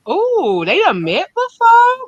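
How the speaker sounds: high, slow gasp of disbelief; shocked surprise. Real, not acted. Host hearing a guest secretly met someone, shocked surprise. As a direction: stretch it out, you can't believe it.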